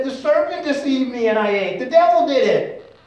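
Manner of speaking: high, loud mock protest; acted-out indignant excuse; self-justifying woman. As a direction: pass the blame on loudly. Acting the woman shifting blame.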